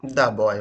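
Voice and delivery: put-on the boy, the sounding like "da"; pronunciation demo. Shown as a demonstration. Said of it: "pronunciation इसका दा है".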